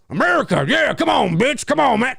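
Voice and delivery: high, loud brash American shout; mock loud American. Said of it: "Americans that sound like that".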